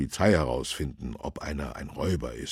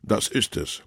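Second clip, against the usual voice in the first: a firm, louder announcement; a touch of a policeman, higher and louder; lightly voiced policeman; 6 semitones higher, 4 dB louder than usual. A narrator's reading. Policeman shows the councillor the house, lightly voiced.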